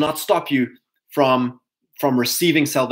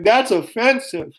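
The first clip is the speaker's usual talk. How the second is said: mock offended; 8 semitones higher, slower than usual. Mocking an offended objector.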